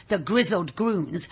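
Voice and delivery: usual speaking voice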